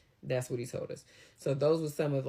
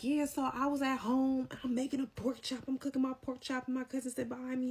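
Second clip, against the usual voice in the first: imitating coworker; 10 semitones higher than usual. Coworker telling his story.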